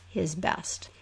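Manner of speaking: ordinary delivery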